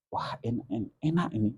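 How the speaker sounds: softly voiced, hesitant praise of the food; hushed polite praise. Monk hushedly praising the cook's salty dish. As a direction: hold back and murmur it kindly.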